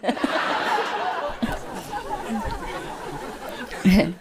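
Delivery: soft breathy fading laugh; quiet laugh. Real, not acted. Teller laughs softly explaining the pun. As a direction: let a quiet laugh trail off.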